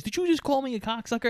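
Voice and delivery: high, fast mock outrage; indignant umpire. Umpire challenging the player who cursed him, indignant.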